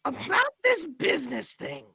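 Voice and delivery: very high, loud squeaky voice; Rocky voice. Acted. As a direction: squeak it like a cartoon critter. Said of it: "he'll look over at Flump and be like".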